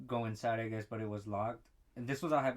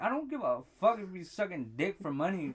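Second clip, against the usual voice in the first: higher and louder mock telling-off; retold heated retort; 7 semitones higher, 7 dB louder than usual. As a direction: tell him off, hammed-up. Retold retort to angry customer.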